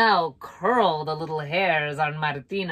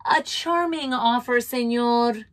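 dryly; 6 semitones higher, 4 dB louder than usual. A narrator's reading. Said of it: "she said dryly".

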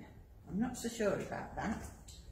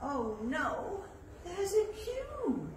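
hammed-up gasp of alarm, pitched up; mock surprise; 11 semitones higher, 4 dB louder, slower than usual. Teacher pretending to spot a queue, mock surprise.

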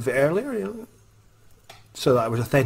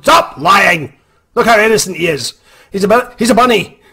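louder, higher scolding mother; scolding mom; 8 semitones higher, 9 dB louder than usual. Retold or imitated. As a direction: snap at the kid like a mother.

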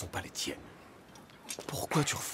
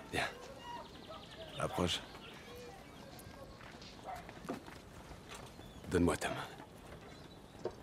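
murmurer lentement, presque sans voix; chuchoté doux; 4 semitones lower, 14 dB quieter than usual. Acted. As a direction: chuchoter pour rassurer le blessé. Soigne un blessé, voix intime. (elle chuchote au guerrier blessé pour le rassurer, murmure lent)

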